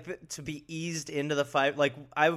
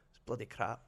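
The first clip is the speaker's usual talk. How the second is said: put-on Scottish accent; 7 dB quieter than usual. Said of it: "he'd just go".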